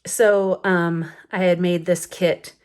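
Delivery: ordinary talk, nothing marked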